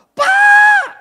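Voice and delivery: very high, piercing imitated shout; full-voiced panicked shriek; caller's scream. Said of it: "Dia teriak".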